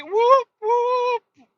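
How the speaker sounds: high, squealing little cheer; happy whoop. Real, not acted. Cheering at the hotel.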